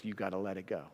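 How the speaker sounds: hushed, gentle voice of God; low and soft, as if God calms his angels; God speaking softly. Acted. God quietly telling the angels to stand down, hushed. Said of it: "And God says".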